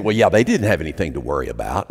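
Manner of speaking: low, quick sanctimonious voice; lightly mock the comfortable churchgoer; smug dismissal. Preacher mocking smug pew-sitters, low and sanctimonious. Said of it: "and we think".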